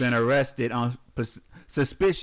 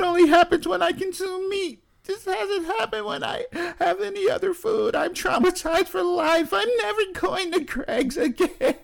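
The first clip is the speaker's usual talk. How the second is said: mock traumatized whine. Mock trauma over bad food.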